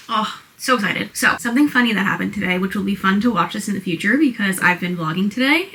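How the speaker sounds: normal talking voice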